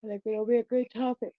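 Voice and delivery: talking through a yawn; words stretched by a yawn. Real, not acted. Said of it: "I'm fucking yawning".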